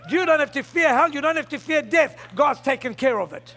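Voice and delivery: ordinary talk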